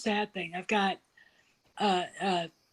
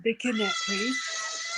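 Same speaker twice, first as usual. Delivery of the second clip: real voice cracking high and slow; words breaking into a sob; much slower than usual. Real, not acted. Friend priest in danger.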